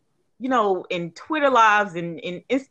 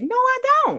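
much higher, emphatic no; indignant refusal; 4 dB louder than usual. Real, not acted. Refusing to watch the videos, indignant. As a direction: slam the door on the idea.

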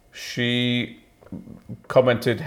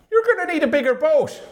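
put-on Irish accent. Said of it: "to do a decent Irish accent".

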